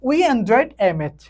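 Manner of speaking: very slow, each English word spaced out; slow dictation. Shown as a demonstration. Teacher dictating an example sentence to students, very slow. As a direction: read it out word by word for the class. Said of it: "teacher reads example sentence".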